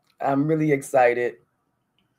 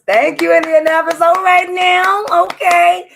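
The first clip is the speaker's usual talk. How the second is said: much higher and louder, thrilled and beaming; excited shout-out; 10 dB louder than usual. Real, not acted. Host thanks Indianapolis listener, thrilled and much louder. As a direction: cheer the city like it made your day.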